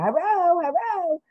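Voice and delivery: mock the squeaky stream voice; chipmunk imitation. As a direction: pitch it way up like a chipmunk. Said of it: "I sound like a chipmunk".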